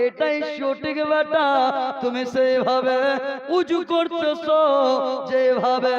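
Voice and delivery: loud, chanting sermon delivery; preaching at full force. Acted. Preacher telling the crowd about Hasan and Husain, full-force preaching. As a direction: preach it to the whole gathering.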